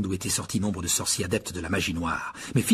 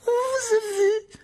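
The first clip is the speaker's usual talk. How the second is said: très aigu, lent, sifflé avec rage; 4 dB louder than usual. A narrator's reading. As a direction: appuyer sur vous en sifflant. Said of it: "siffla-t-il". (voix sifflante, triomphante)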